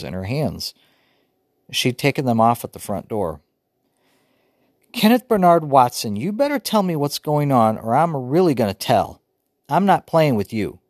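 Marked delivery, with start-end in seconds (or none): 4.9-10.8 stern mother voice, high and loud; sister imitating Mama. A narrator's reading. Little sister scolding her brother, imitating Mama. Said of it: "Joey was imitating Mama".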